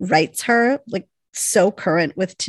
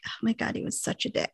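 fast whispered aside; whispered dismay at Wyatt; hushed disgust; 10 dB quieter, faster than usual. Retold or imitated. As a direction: mutter it under your breath, disgusted. Winona's disgusted realization.

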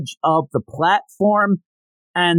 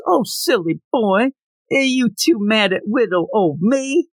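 coy flirt; 6 semitones higher than usual. Retold or imitated. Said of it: "She tries first, goes".

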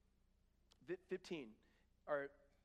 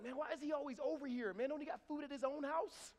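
high-pitched, whiny complaint; mock grumbling; 9 semitones higher than usual. Householder complaining about the kid eating snacks, mock exasperated. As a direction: gripe about an unwanted guest.

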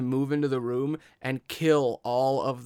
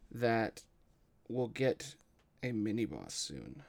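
ordinary delivery

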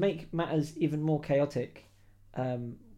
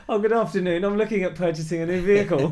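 higher, louder overly polite phone voice, laughing; 5 semitones higher, 9 dB louder than usual. Retold or imitated. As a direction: put on a posh customer-service politeness. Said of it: "I went there with my phone voice".

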